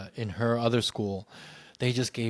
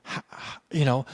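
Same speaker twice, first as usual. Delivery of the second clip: usual speaking voice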